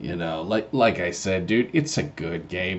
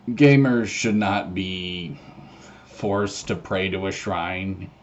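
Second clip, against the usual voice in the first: slow emphatic; 3 dB louder, slower than usual. Real, not acted. Mock solemn protest.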